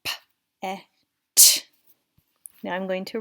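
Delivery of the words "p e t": P-E-T sounded out loud, sound by sound; segmenting sounds. Shown as a demonstration. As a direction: sound out P-E-T slowly and loudly. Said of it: "segment it and listen to the final".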